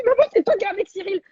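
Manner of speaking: put-on high, fast child's voice; be the amazed little boy; her little son. Her son asking if it's mom on TV, high child voice. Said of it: "et il me dit".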